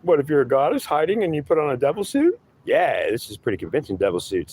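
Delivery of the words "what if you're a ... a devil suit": high, pleading whine of a lost believer; whiny seeker voice. Acted. Seeker whining back at his God, high and whiny. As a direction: whine it up high like a fed-up crybaby. Seeker questions the devil figure.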